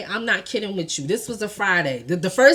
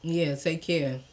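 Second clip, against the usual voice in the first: low, quiet, cool goodbye; unsmiling send-off; 5 semitones lower, 8 dB quieter, slower than usual. Retold or imitated. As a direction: mimic him saying bye without a smile. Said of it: "he's just looking at me like".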